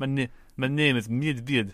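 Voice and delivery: light mock Russian accent. Player introducing his bear character, Russian accent. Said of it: "keeps me in, in the accent".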